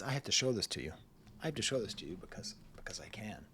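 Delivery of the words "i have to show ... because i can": breathy whisper, conspiratorial; whispered aside. Real, not acted. Reviewer about to show a funny note, sly whisper. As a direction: let them in on a secret, whispering.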